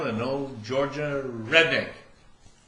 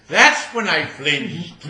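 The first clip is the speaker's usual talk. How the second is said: slow, loud, laughing through it; laughing punchline; 6 semitones higher, 10 dB louder, much slower than usual. Acted.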